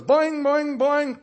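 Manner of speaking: high, loud springy boing sounds; bouncy boing. Retold or imitated. Preacher imitates the sheep bouncing back in, springy boing. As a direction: bounce the word like a happy sheep.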